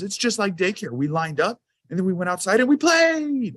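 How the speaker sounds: put-on high, casual kid voice; little boy voice. Dad imitating son describing the drill, high kid voice. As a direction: shrug it off like a breezy kid.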